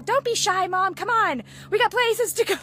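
put-on high, loud impatient kid egging mom on; loud, high-pitched mock kid nagging; urging child. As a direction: imitate a child cheerfully pushing mom along. Acts out her child urging her on.

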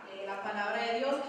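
ordinary talk

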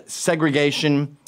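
ordinary delivery

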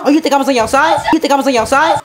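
loud, with a laugh in it; playful loud disbelief; loud laughing mock outrage. Real, not acted. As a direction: act betrayed, half joking.

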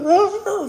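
shrieking high laugh; high squealing laugh. Real, not acted. Laughing at tickle story.

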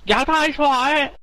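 high, slow fake Chinese accent; a slow, high put-on Chinese accent. Pretend Chinese farmer gives his name, fake Chinese accent. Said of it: "I can't do the accent".

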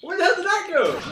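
high, loud cry of disbelief; real exasperated outburst; baffled yell. Real, not acted. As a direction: shout it high, utterly baffled. Loses the spear in game.

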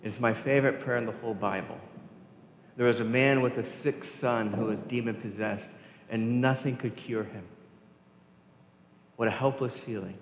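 normal talking voice

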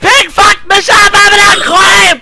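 high raging gamer scream; panicking gamer. Real, not acted. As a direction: scream it in frustration. Gamer rage outburst.

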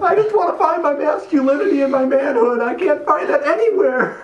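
high, exaggerated weepy whine; crying Jed. Mocking his co-star through the role, hammed-up crying. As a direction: sob through it in mock despair. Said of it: "I don't sound like that".

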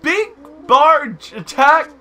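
high, loud squawk, word by word; boastful game bird. Acted. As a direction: announce it in a slow cartoon squawk. Reads game dialogue in character.

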